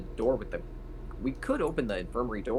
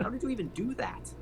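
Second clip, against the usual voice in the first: much higher, amazed disbelief; surprised; 12 semitones higher, 4 dB quieter than usual. Pretend. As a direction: squeak it out in disbelief. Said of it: "I'm just like".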